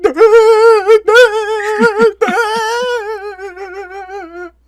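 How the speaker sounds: very high, loud, drawn-out droning wail; droning duh wail. Retold or imitated. A crying kid imitating the woods man to grandma, long high wail. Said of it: "and he just said".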